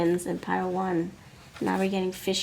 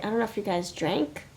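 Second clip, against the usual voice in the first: ordinary talk, nothing marked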